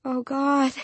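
high, thin cry of dismay; anguished cry. A narrator's reading. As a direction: cry it out without volume. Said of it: "she cried".

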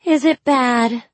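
high, loud, slow moan of worry. A narrator's reading.

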